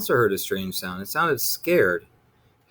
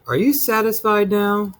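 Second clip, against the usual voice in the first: 1 exasperated mother; 9 semitones higher, 4 dB louder than usual. A narrator's reading.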